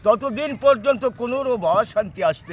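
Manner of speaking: melodramatic folk theatre. Acted. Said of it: "একটা যাত্রার dialogue দিচ্ছি".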